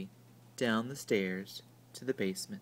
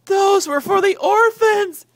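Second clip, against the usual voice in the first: very high, loud, slow shriek; high loud shrieking aunt; 16 dB louder, slower than usual. A narrator's reading. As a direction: shriek in outraged dismay. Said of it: "shrieked Aunt Figgy".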